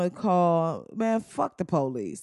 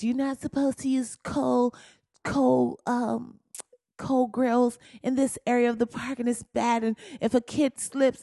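Barbecue Becky; 5 semitones higher than usual. Retold or imitated. Voicing Barbecue Becky's complaint.

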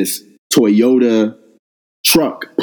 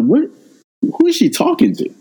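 stunned disbelief. Retold or imitated. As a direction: voice jumps very high in shock. Shocked by slur from stranger.

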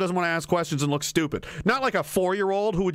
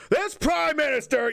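higher-pitched, quick burst of mock anger; mock angry; 7 semitones higher than usual. Mad politician in the meme, mock angry. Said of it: "he's, like, mad".

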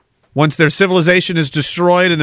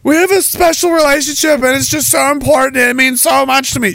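higher, gushing put-on voice; mock earnest supporter; 8 semitones higher than usual. Canadian gushing about the special relationship, mock earnest voice. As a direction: gush like a smitten fan.

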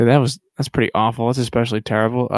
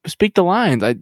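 ordinary talk, nothing marked

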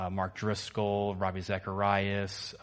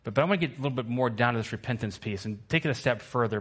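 ordinary delivery

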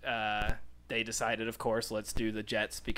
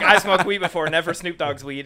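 loud, high excited storyteller voice; excited retelling; 6 semitones higher, 11 dB louder than usual. Retold or imitated. Telling the talk show about the party, loud and high. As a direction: bubble over as you tell it. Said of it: "she's like".

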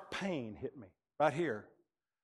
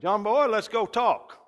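slow, loud, hearty preacher voice; bold older preacher; 8 semitones higher, 8 dB louder, slower than usual. Retold or imitated. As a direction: boom it out like a big-hearted mentor. Older preacher calling him up.

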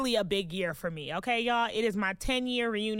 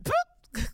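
high squeaky pop noise; playful pop; 3 dB louder than usual. Real, not acted. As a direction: pop the spoiler like a bubble. Spoiler reveal sound.

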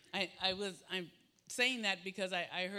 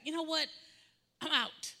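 frustrated outburst; 7 semitones higher, 3 dB louder than usual. Retold or imitated.

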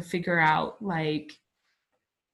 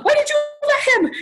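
very high, fast, louder accusation; accusing mom; 7 dB louder, much faster than usual. Retold or imitated. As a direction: throw it at mom in near-despair. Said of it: "my mom".

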